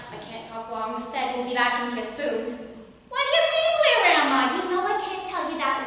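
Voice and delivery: hammed-up New Jersey housewife accent; bad accent. Housewife spilling a secret to her friend Marjorie, bad New Jersey accent. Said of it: "ready for a bad accent".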